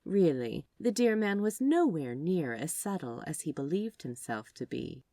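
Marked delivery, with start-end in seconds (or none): none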